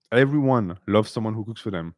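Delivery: normal talking voice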